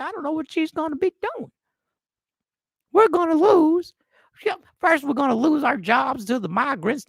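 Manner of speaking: exaggerated country twang, worked up; bad country accent. Bar regular fretting about losing country music, hammed-up country accent. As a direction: play an outraged Southern country bar regular. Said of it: "That is the worst country accent ever".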